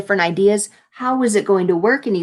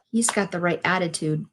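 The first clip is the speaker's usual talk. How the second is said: usual speaking voice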